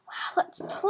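awed whisper. Real, not acted. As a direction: breathe it out in wonder.

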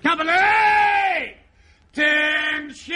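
high, stretched parade-ground command; sergeant major. Acted. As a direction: shout orders across the parade ground. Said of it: "the sergeant major's out there".

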